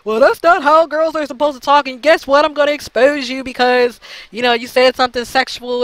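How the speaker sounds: put-on voice of indignant complainers; mocking her critics. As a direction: imitate a prissy, disapproving critic. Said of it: "then they be like".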